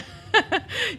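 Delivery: short high burst of real laughter; brief real laugh at the irony. Real, not acted. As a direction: break into a quick amused laugh.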